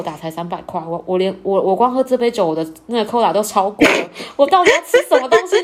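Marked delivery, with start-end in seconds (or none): 4.4-5.6 higher and louder, a frantic inner cry; panicked inner thought. Retold or imitated. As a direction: blurt it out in a sudden panic. Inner panic over menu prices. (心里慌张地高声喊)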